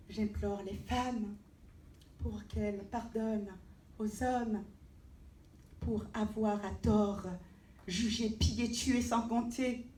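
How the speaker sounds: declamatory verse with measured pauses; poem recitation. Acted. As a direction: recite it as an impassioned plea, swelling then falling at stanza ends. Recites own poem aloud.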